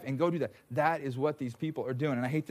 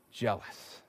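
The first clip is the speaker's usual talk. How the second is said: whispered; 5 semitones lower, 7 dB quieter than usual. Acted. Pastor telling congregation God's nickname, whispered. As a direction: whisper the word Jealous.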